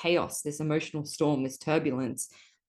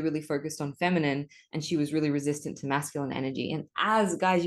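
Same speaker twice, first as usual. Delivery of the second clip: ordinary delivery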